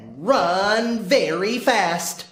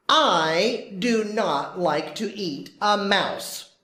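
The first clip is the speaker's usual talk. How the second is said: ordinary delivery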